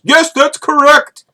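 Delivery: put-on high, slow Indian-accented recruiter voice; hammed-up Indian accent; mock Indian accent. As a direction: imitate an Indian recruiter on the phone, pitched well up. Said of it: "from Indian recruiters".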